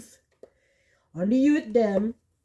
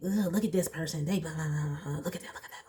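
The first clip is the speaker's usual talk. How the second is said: snide gossip; 3 semitones lower, 7 dB quieter, much faster than usual. Retold or imitated. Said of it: "always hating on somebody".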